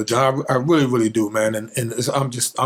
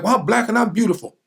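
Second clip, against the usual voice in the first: put-on higher, proud little-boy voice; child's proud reply; 8 semitones higher than usual. Boy answering the mother who sent him home, proudly. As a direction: talk back like a proud four-year-old. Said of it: "And I was like".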